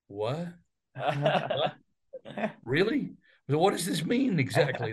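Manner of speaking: mildly baffled, questioning lilt; retold bewilderment. Young broadcaster questioning a station manager's surprise offer, mildly incredulous. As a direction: act out puzzled disbelief, pausing between questions.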